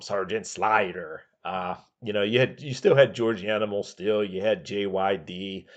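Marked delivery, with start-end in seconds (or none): 0.0-1.2 slow, lightly put-on old manager voice; Freddie Blassie impression. Imitating Freddie Blassie mangling the wrestler's name, slowly. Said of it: "as Freddie Blassie would call him".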